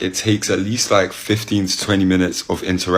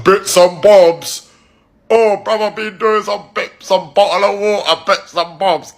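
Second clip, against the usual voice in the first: put-on high, drawn-out English accent parody; hammed-up English accent, pitched way up; mocking English accent; 12 semitones higher, 4 dB louder, slower than usual. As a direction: parrot it back in an overblown English accent. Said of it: "I speak like this. This is just my voice".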